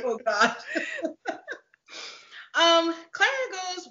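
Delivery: usual speaking voice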